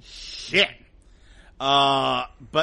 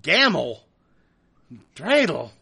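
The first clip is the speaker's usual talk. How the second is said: normal talking voice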